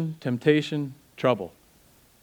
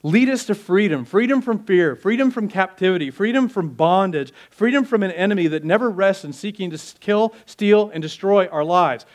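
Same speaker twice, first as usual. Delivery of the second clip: preaching at full force; 4 semitones higher than usual. Acted. Preaching climax.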